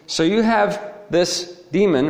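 ordinary talk, nothing marked

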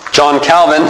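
normal talking voice

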